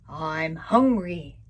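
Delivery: low, slow, loud growling lion; lion roaring through the jungle, low and slow. Acted. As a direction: growl it out like a starving beast. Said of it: "roared the lion".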